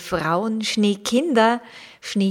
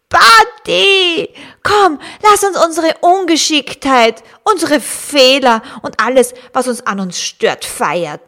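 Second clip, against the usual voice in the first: high, loud party call; excited; 6 semitones higher, 8 dB louder than usual. Acted. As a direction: shout it like a celebration. Hare proposes a party.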